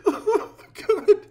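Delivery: high shrieking laugh-apology; real uncontrollable giggles; laughing fit. Real, not acted. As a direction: gasp out the sorry through laughter. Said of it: "I'm sorry".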